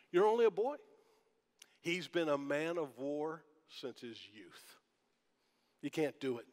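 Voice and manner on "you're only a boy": dismissive King Saul. Retold or imitated. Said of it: "Notice Saul's response".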